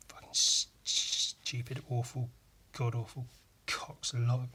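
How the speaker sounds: muttered under the breath; whispered. Real, not acted. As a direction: hiss the insult quietly to yourself. Muttered insult aside.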